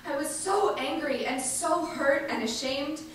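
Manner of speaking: ordinary talk, nothing marked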